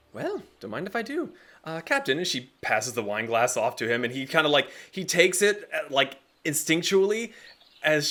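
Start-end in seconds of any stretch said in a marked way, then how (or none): 0.1-2.2 playful lady. Acted. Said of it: "She goes".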